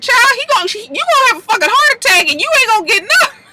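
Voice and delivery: high-pitched, rushed exclamation; worked up, voice shooting way up; amused outburst. Acted. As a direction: warn her in a high, hurried squeal. Amused outburst at character's anger.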